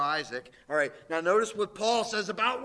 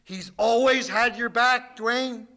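louder, slow promise; preaching at full force; 2 semitones higher, 4 dB louder than usual. Acted. Preacher assuring the congregation that God has their back, louder. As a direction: reassure the whole room.

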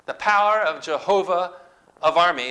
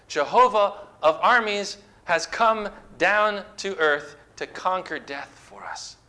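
ordinary talk

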